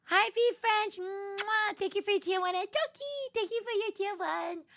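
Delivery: high, sing-out thank-you with blown kisses; high-pitched cutesy streamer voice; cutesy gushing thanks. Real, not acted. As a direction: gush sweetly at supporters.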